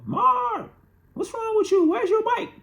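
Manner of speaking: mock mom shouting in very high falsetto; mother's alarmed yell. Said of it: "she comes back out the front door".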